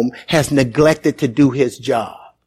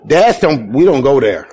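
normal talking voice

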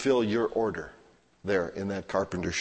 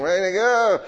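loud, higher sarcastic "Way to go"; mocking jeer; 9 semitones higher, 7 dB louder than usual. Onlookers tease the person who slipped with a loud mocking jeer. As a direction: jeer at him like onlookers teasing. Friends mock the faller.

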